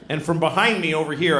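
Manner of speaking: ordinary delivery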